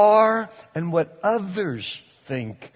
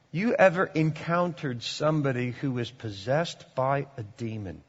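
ordinary delivery

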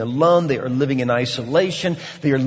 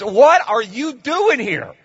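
loud, much higher hostile challenge; demons defiant; 8 semitones higher, 6 dB louder than usual. Acted. Demons confronting Jesus, loud high hostile challenge. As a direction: hurl it like a snarling demon. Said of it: "that's what these demons are saying to Jesus".

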